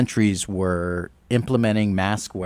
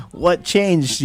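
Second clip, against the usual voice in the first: high, puzzled retold question; a lightly hammed-up baffled ask; mock surprise; 9 semitones higher than usual. Retold baffled reaction to governor.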